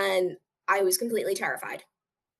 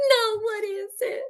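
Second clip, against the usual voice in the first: 1 clueless nurse; 12 semitones higher than usual. Retold or imitated. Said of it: "She's like".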